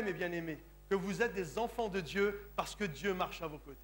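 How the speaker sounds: normal talking voice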